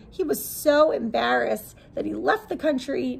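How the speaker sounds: plain reading voice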